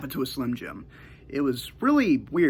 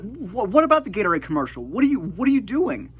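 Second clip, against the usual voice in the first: high, loud angry mom demanding answers; furious mom; 9 semitones higher, 5 dB louder than usual. Retold or imitated. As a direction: mom confronting her kid in the bathroom. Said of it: "she was furious".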